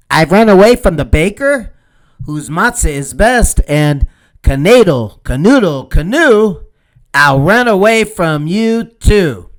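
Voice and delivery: nose in the air, bragging; cheeky boast. Acted. Matzah man boasting to hen.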